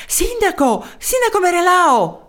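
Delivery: high, urgent hail after running; winded calling out. A narrator's reading. Breathless townsman running to the mayor, high urgent call. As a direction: call out high, as if just run up. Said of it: "con la voce affaticata dalla corsa".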